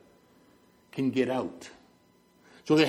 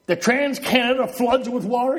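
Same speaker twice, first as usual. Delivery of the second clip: high incredulous quoted question; mock disbelief; 7 semitones higher, 4 dB louder than usual. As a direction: act out an astonished visitor, pitched up. Retold visitor's disbelief at flooding.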